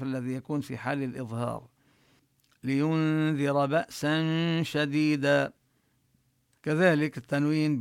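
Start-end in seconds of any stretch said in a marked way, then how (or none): 2.6-5.5 slow chanted tartil; recitation. Shown as a demonstration. Teacher reciting for students, slow tartil. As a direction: recite slowly and evenly. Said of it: "tajweed recitation demo".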